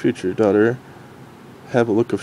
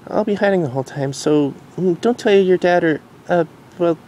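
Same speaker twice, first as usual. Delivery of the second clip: higher, gentle mare voice; reassuring Derpy; 9 semitones higher than usual. Acted. Derpy hugging Dinky, gently reassuring.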